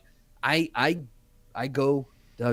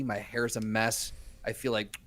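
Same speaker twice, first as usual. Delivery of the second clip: normal talking voice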